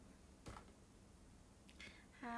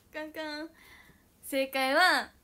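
excited reveal; 4 semitones higher, 12 dB louder, slower than usual. Real, not acted. Announcing quiz answer.

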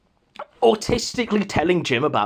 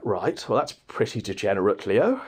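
deadpan mockery; 9 semitones lower, 5 dB quieter than usual. Dry mocking reply.

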